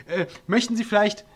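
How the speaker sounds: calling out. A narrator's reading. Mittelmeier calling down to the vanished dwarf, loud and high. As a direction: call out the coffee offer so it carries below. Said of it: "rief er dann".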